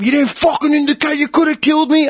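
high-pitched outraged driver; retold road-rage rant; mock screaming. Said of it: "he starts screaming".

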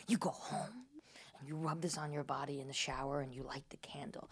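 soft, low unvoiced instructions; secret whisper. Psychic giving secret instructions.

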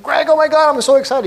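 high, loud gush of excitement; excited fan. Retold or imitated. Speaker retells meeting his mentor Greg, high and loud with excitement. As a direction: gush like meeting your hero. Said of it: "And I said".

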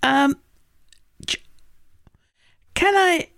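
very high, halting voice; high hesitant droid voice. Acted. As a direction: stammer it out high and unsure.

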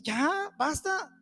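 high-pitched, exasperated command; exasperated exhortation. Acted. Preacher telling the self-pitying to stop, exasperated.

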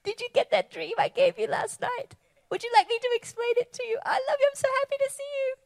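high, delighted, bubbling voice of God; God greeting you. Retold or imitated. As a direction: play God thrilled to see you wake up. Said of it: "he's like".

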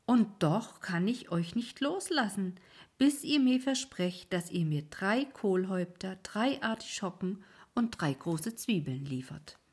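firm terms from the boatman; insistent old ferryman. Acted. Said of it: "ferryman holds them to a promise".

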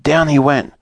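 usual speaking voice

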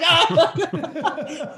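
imitating her high startled yelp; a quick mock scream of fright; startled shriek. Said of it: "She was like".

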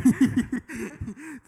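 high, loud burst of laughter; cracking up out loud; rising hearty laugh. Real, not acted. Laughter after joke.

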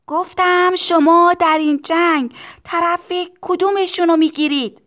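put-on high child's yell over noise; shouted repeat. A narrator's reading. Tistu repeating his question for the owner, high shout. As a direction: repeat it loudly, pitched high.

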